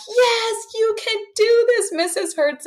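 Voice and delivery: high mock children's shout-back; imitating kids shouting. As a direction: cheer it back like a class of kids. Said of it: "they usually shout back".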